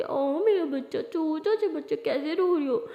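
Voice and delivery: put-on slow, cooing baby talk. Friend teasing a crying classmate as her baby, babying coo. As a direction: coo as if to a tiny child in tears.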